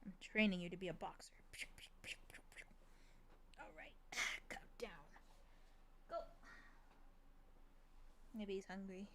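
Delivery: soft near-whisper; hushed play with the cat. Real, not acted. Cat owner softly playing with her cat, hushed.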